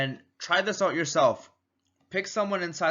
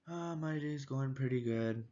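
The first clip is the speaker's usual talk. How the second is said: much softer, flat-toned reply; low-energy mismatch; 10 dB quieter than usual. Shown as a demonstration. Salesman failing to match a cheerful customer, much softer. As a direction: answer with none of their energy. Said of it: "they're not gonna be able to connect".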